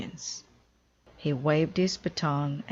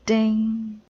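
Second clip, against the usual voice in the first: triangle ding; 6 semitones higher, 6 dB louder than usual. Acted. Amelia hits triangle once.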